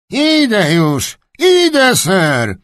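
high, drawn-out nasal answer from afar; sharp nasal voice. Acted. Kennelman answering the duke from behind a building, sharp and nasal. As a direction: call back sharply through the nose. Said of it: "Ostry, nosowy głos psiarczyka".

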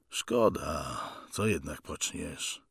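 low, soft acceptance; father's resigned reply; quiet resignation. Acted. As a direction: accept it with subdued regret.

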